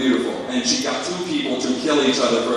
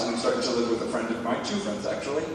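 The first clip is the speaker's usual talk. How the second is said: ordinary talk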